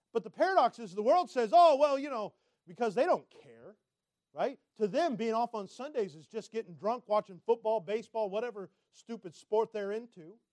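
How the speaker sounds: loud, driving sermon delivery; full-force preaching. Acted. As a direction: press the point loudly from the pulpit.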